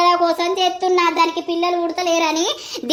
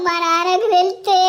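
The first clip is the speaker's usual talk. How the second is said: normal talking voice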